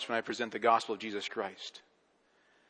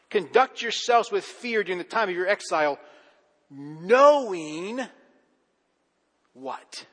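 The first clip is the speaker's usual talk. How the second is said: building sermon voice; 5 semitones higher, 4 dB louder than usual. Acted. Preaching rises again.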